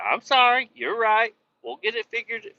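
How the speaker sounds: high-pitched imitation of an apologizing coworker; put-on high voice, conceding; imitated apology.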